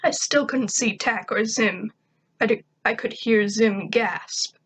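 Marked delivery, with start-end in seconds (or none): none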